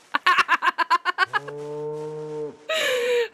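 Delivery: high drawn-out giggle; long giggle. Real, not acted. Streamer cracking up at the game cow, long giggle. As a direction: giggle helplessly.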